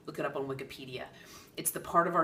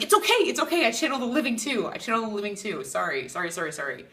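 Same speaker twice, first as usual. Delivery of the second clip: flustered reassuring; 6 semitones higher, 6 dB louder than usual. Retold or imitated. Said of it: "And I'm like".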